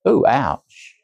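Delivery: usual speaking voice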